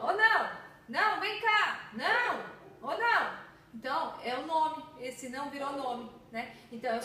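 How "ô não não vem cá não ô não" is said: loud, high call to a pet across the yard. Pretend. Teacher pretends to call her pet named Não, raised calling voice. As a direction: call the little dog back, raised and higher. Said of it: "no meu bichinho de estimação de não".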